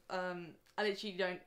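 normal talking voice

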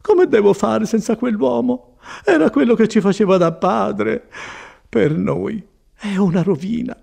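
high-pitched, tearful lament; almost crying. A narrator's reading. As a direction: despair as if tears are coming. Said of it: "quasi piangendo".

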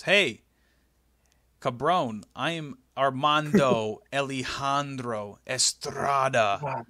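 put-on slow, rolled Spanish accent introducing himself, a laugh breaking in; mock Spanish accent. Estrada introducing himself to Vince backstage, mock Spanish accent. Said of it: "and he goes".

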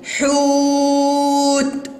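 "حوت" with ū held very long on a high level note; strong long damma. Shown as a demonstration. Teacher checks for madd with waw, drawn-out monotone. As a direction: intone ḥūūūt, stretched out. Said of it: "إنها ضمة قوية وشديدة".

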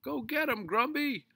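high, loud egging-on; yelled cheer. A narrator's reading. Neighbour cheering on Mister Grumby's chase, high yell. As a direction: yell encouragement across the street. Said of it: "yelled a guy across the street".

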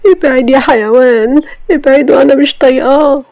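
put-on high, loud tearful girl pushing him away; young wife screaming at her husband to get away; crying and yelling. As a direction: shout through tears at him. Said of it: "وفضلت تعيط وتصرخ".

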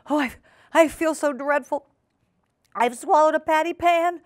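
high, hammed-up wail; moaning whine. A narrator's reading. Duchess lamenting the swallowed pan, moaning and whining. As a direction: whine loudly in woe. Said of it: "Duchess moaned and whined".

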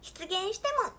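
put-on playful high-pitched cat-girl plea; cutesy cat-girl. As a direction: plead like a cute kitten. Cutesy twin-tail cat catchphrase.

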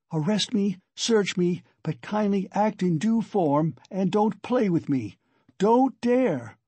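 high, tense defiance; acted fury. A narrator's reading. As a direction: build to a sharp warning. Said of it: "driven to even greater fury".

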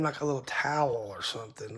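ordinary talk

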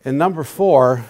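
ordinary delivery

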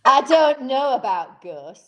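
loud, slow, broad Yorkshire; best Yorkshire accent. Retold or imitated. Host imitates Harry telling of ghosts, broad Yorkshire accent. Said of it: "in my best Yorkshire accent".

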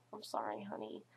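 very low, hushed put-on voice; mock sheepish apology. Apologising to the theater person over old candy, mock sheepish. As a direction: drop deep and soft to apologise. Acts a guilty apology to gift recipient.

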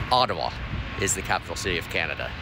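soft, hushed and fast; quiet. Acted. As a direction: tell it softly in confidence. Secret message at video's end.

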